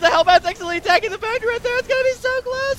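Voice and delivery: rising excited commentary. Real, not acted. Casting a nail-biting finish.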